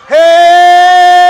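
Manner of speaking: long loud high "hey"; sustained pulpit holler; preacher's shout. Acted. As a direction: let out a joyful shout. Preacher exclamation.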